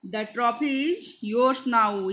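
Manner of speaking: very slow dictation; very slow example read. Shown as a demonstration. Teacher reading example to students, very slow. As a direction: stretch each word for the class.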